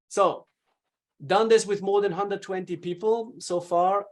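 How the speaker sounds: normal talking voice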